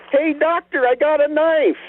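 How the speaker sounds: high-pitched call across a parking lot; retold yell. A girl in the parking lot calls out to Brent, high yell. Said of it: "yells across the parking lot".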